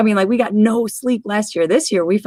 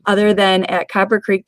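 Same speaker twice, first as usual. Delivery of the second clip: ordinary delivery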